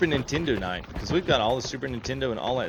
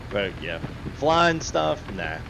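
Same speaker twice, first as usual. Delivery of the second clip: ordinary delivery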